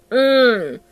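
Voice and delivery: usual speaking voice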